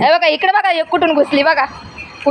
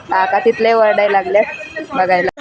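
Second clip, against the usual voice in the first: ordinary talk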